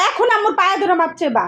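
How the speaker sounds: normal talking voice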